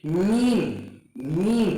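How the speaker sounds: मीम with moist inner lips closing; model pronunciation. Shown as a demonstration. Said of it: "जैसे".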